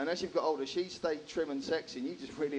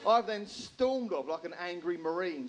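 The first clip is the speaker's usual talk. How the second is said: normal talking voice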